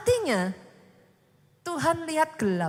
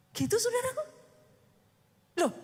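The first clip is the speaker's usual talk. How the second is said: ordinary talk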